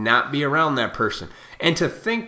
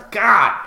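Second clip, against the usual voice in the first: laugh; 7 semitones higher, 8 dB louder than usual. Real, not acted. Laughing after yelling at viewers, amused. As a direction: burst of high laughter, then a loud sigh. Laughs at his own outburst.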